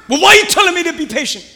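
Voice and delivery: high, loud and fast complaint; whiny impatient believer. Pretend. Believer protesting to God, high and fast. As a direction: rush it out like a frustrated child.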